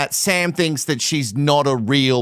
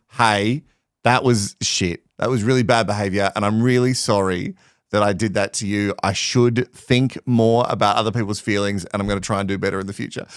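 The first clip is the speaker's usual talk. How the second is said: lower, lightly voiced contrite guy; a hint of a sincere apology, lower; imitating a sorry man; 8 semitones lower than usual. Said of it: "to go".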